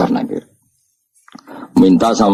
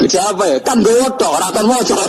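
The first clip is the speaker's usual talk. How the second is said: words squeezed out through high laughter; can barely speak for laughing; real laughing talk, pitched high; laughing while talking; 12 semitones higher than usual. Real, not acted.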